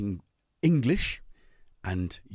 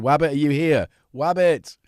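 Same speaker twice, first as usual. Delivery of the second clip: calling out; 5 semitones higher, 8 dB louder than usual. Real, not acted. Calling out to chat member.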